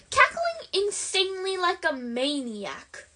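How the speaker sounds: ordinary delivery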